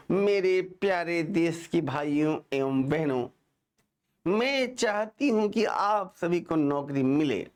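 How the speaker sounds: put-on slow, stilted foreign-accented Hindi speech; drawn-out syllables, addressing the nation word by word; Sonia Gandhi. Teacher imitates a politician addressing countrymen, slow accented Hindi. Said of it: "Sonia Gandhi से बात करेंगे तो कहेंगी".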